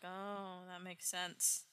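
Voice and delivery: very soft, low-key acceptance; subdued realisation. Retold or imitated. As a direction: say it small, like a sheepish gang catching on. Gang meekly accepts the correction.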